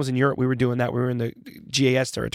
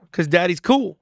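higher, playful dad brag; playful boast; 5 semitones higher, 4 dB louder, slower than usual. Retold or imitated. Dad joking to his young daughter, playful boast. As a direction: brag lightly, as a joke to a kid. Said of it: "And I went".

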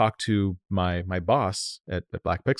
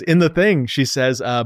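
usual speaking voice

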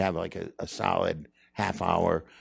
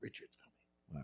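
low secret whisper; hushed whisper; 4 semitones lower, 22 dB quieter than usual. Retold or imitated. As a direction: whisper it like a rumor. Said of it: "Why are we whispering?".

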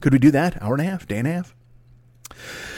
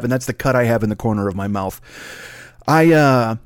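ordinary delivery

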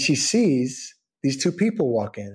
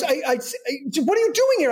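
stammering, high-pitched amazement; flustered; 13 semitones higher, much faster than usual. Retold or imitated. Co-star asking why he is in Paris, flustered. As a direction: mimic him tripping over his surprise. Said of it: "like, flustered".

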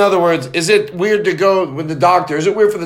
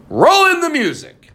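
high, slow booming intro; show announcer; 10 semitones higher, slower than usual. Shown as a demonstration. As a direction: hype up the host's name. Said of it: "you like that?".

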